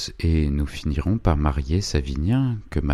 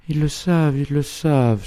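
much higher, loud "Ils le savent" cried twice; alarmed cry; 11 semitones higher, 4 dB louder than usual. A narrator's reading. Mother learning the rich know her son is jailed, crying out. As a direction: cry out in alarm, arms raised. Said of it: "s'écria-t-elle".

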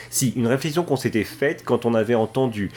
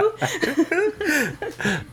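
high laugh. Real, not acted. Laughing at the joke.

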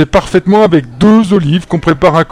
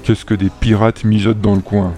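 lower, softer suspicious growl; 8 semitones lower, 5 dB quieter than usual. A narrator's reading. Reda suspects the pirates, low softer growl. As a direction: grumble it under your breath. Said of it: "grogna Reda".

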